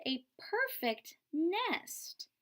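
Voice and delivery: slow, high, delighted goose; high goose, savoring the nest. Acted. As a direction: drawl out the praise as the goose. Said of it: "she cried".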